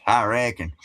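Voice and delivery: light Appalachian hillbilly twang; hillbilly accent. Shown as a demonstration. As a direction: toss it off in hillbilly dialect. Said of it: "it's hillbilly".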